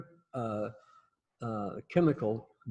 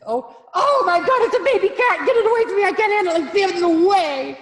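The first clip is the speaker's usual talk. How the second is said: much higher, louder shrieking voice; mock panic; 12 dB louder than usual. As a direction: ham up a panicked child afraid of a kitten. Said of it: "And I said".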